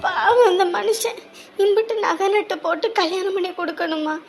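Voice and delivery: heavy-hearted confiding. Acted. Wife confides her worry about her husband, low and sorrowful.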